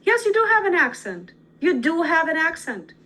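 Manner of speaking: high, loud stress on "do"; emphatic rebuttal. Real, not acted. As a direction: insist on it, punching "do" high. Emphatic rebuttal of a quoted worry.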